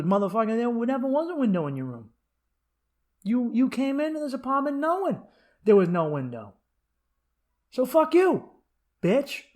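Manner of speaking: mock-chew out the tenant; angry landlord. Landlord telling off the tenant, mock high angry.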